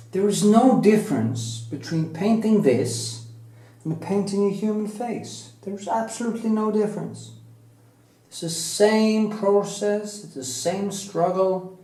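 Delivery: passionate insistence. Real, not acted. Passionate defence of onion painting.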